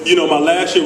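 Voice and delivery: ordinary talk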